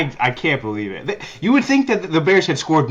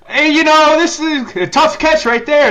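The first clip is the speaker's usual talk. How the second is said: sing-song, much higher mock of a TV announcer; 14 semitones higher, 6 dB louder than usual. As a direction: parody the announcer's gushing praise, pitched way up. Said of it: "They're like".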